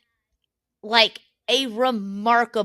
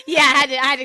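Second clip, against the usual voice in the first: laughing; 4 semitones higher, 6 dB louder, much faster than usual. Real, not acted. Laughing after hermit crab news.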